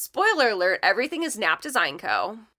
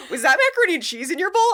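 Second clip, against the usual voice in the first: fast awkward blurt; 9 semitones higher, 7 dB louder, faster than usual. Retold or imitated. Retold awkward quote.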